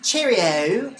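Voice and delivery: mock British accent. Mock British greeting to viewer.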